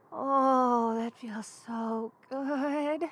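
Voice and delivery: slow, soft, contented sigh of words; slow and soothed; blissful relief. Acted. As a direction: melt into the warmth, drawing it out. Warming up with hot drink.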